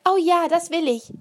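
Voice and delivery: put-on high, loud thrilled child; delighted little girl. A narrator's reading. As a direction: burst out with delight. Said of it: "sagte Isa begeistert".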